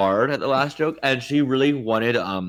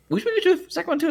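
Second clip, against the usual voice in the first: very high, fast joking aside; squeaky fast aside; much faster than usual. Real, not acted. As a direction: toss it off as a quick squeaky joke.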